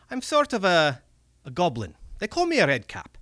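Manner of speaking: high, excited Scottish goblin; wheedling little red cap creature voice. Acted. Goblin speaks in story.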